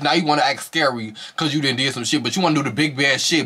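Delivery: ordinary talk, nothing marked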